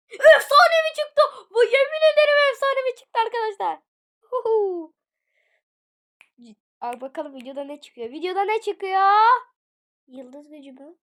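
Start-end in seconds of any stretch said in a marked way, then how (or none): 0.1-4.9 very high, very loud celebration; ecstatic shouting. Real, not acted. Wins a legendary item. 8.1-9.1 loud, high amazed shout; excited exclamation. Real, not acted. Repeats it, amazed by prize.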